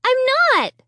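high, furious shriek. A narrator's reading. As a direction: shriek it again. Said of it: "I shrieked".

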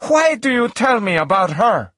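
high-pitched suspicious challenge. A narrator's reading. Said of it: "suspecting something bad".

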